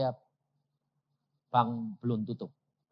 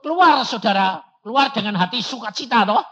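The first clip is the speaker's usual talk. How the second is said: raised, high, ringing proclamation; loud preaching; 8 semitones higher, 12 dB louder than usual. Real, not acted. Preacher exhorting the congregation, loud and jubilant. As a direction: call it out to the whole church.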